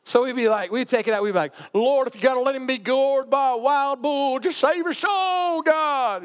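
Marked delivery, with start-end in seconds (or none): 1.7-6.2 very high, wailing preacher prayer; mock preacher voice. Friends exaggerating their buddy's prayer, hammed-up preacher voice. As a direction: stretch the plea out like an over-the-top preacher. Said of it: "he'd do it in a preacher voice".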